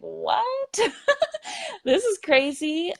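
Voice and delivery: high, startled "What?"; shocked boss. Retold or imitated. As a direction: play the boss caught off guard. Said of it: "And they're like".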